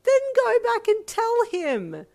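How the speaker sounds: very loud, high, drawn-out order; exasperated. A narrator's reading. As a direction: yell the obvious answer, fed up. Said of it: "her sister said with exasperation".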